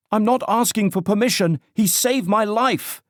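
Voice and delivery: high, loud insistent shout; near shout. A narrator's reading. Young woman shouting down at her friends who want to leave him. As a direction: she demands it over everyone's heads. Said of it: "a female voice practically shouted above him".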